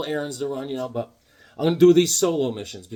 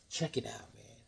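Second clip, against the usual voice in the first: whisper; 6 semitones lower, 16 dB quieter than usual. Real, not acted. Showing off new comics.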